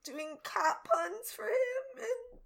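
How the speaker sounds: teary, slow gushing; near tears. Real, not acted. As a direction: gush while choking up. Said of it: "I'm gonna cry".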